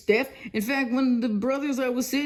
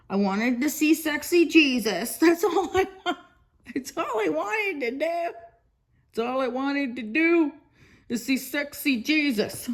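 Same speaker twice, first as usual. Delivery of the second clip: giggling; 4 semitones higher than usual. Real, not acted. Giggly gushing.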